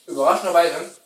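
ordinary talk, nothing marked

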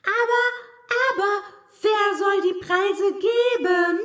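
high, loud clamouring question; chorus of voices. A narrator's reading. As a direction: cry it out like a whole crowd. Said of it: "fragte ein ganzer Chor von Stimmen".

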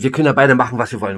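ordinary delivery

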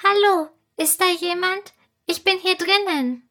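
high, tiny piping voice; a little fairy calling out, high and small; squeaky fairy voice. A narrator's reading. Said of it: "eine leise, piepsige Stimme".

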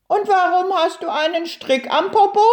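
high, quick questioning turtle voice; curious little turtle. A narrator's reading. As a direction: ask eagerly as a puzzled turtle.

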